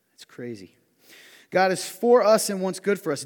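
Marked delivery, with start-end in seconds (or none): 1.5-3.2 loud emphatic preaching. Acted. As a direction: drive the point home to the congregation.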